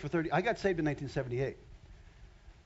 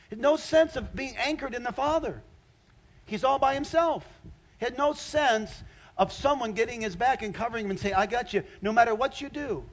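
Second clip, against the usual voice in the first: full-force preaching; 7 semitones higher, 4 dB louder than usual. Acted. Pastor to congregation about Saul, full-force preaching. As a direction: lean in and preach it hard.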